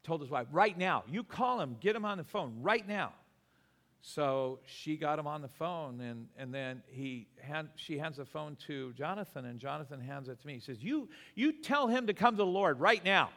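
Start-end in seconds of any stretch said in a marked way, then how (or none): 0.5-3.1 loud, higher, insistent demand; urgent command. Retold or imitated. Sick man ordering his wife to phone his friend, urgent command. 10.8-13.3 loud, high-pitched urgent command; insistent demand. Retold or imitated. Jonathan hands the preacher the phone, insistent demand.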